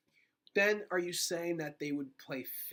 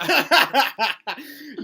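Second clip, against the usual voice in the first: loud laugh; 8 semitones higher, 13 dB louder than usual. Real, not acted. Laughing after a joke.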